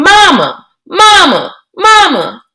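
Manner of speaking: high screamed calls up the stairs; screaming for Mama. Acted. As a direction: scream for your mother in panic. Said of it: "ran up the stairs screaming".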